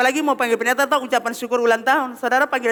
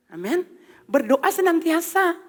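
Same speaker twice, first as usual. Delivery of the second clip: ordinary delivery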